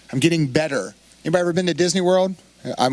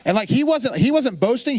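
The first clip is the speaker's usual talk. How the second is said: ordinary talk, nothing marked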